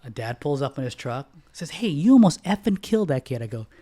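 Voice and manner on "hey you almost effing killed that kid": louder, higher mock angry dad; furious driver shouting at the son; retold angry yell. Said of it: "why are you yelling at me".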